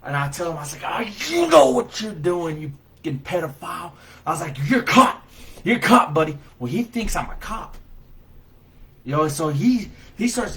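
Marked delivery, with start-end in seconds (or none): 0.9-3.9 retold angry outburst. Angry rescuer confronting a suspected predator, retold outburst. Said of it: "I'm so angry, and I'm so frustrated". 4.7-6.4 loud, high, repeated accusation; retold angry shout. Rescuer telling the man he's caught, angry raised voice. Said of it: "I'm so angry".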